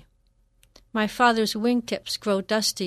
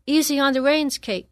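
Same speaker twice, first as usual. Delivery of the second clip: higher, louder riding instructor's call; shout; 5 semitones higher, 5 dB louder than usual. Acted. Riding teacher shouting to a rider across the ring. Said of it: "Ellen shouts".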